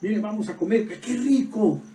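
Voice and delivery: delighted. Retold or imitated. Friend's delight voiced.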